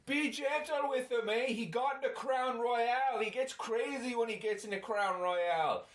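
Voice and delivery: put-on high, yelling Canadian drunk buddy; Canadian accent. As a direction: plead with the cops, Canadian style. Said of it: "his buddy is yelling at the cops going".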